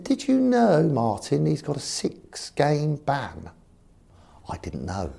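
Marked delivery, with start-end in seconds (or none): 0.0-3.5 high posh voice, slowing on the ban; posh chairman. Retold or imitated. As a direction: let the posh chairman drop the news slowly. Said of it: "He's very posh".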